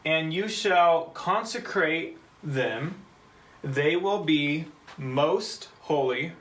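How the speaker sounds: "They will be most holy" read very slowly, word by word, rising at the end; slow reading aloud. Shown as a demonstration. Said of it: "We'll read it in English".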